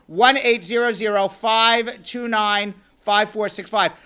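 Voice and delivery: quick, loud run through the digits; brisk announcer number read; number read fast. Shown as a demonstration. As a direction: rattle the number off in one breath. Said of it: "I may be saying that number very, very fast".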